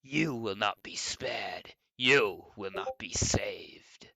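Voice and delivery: very slow, ending in a strained whisper; strained Springtrap voice. Pretend. Streamer redoes his old Springtrap voice. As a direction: let the threat die to a strained whisper. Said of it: "used to be able to do it".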